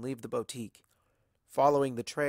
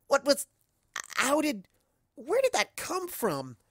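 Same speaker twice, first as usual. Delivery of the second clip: much higher, stammering and slow; flustered shock; 13 semitones higher than usual. Acted. Narrator baffled by the explosion, high and flustered. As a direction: grasp for words.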